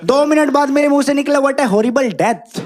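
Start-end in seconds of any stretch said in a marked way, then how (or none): 1.4-2.6 "horrible" as "हॉरिबल", very slow and heavy; "death" said clean, "horrible" as a thick Hindi "हॉरिबल"; Hindi-sounding English. Retold or imitated. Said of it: "मेरे मुंह से डेथ सुना कितना accurate है".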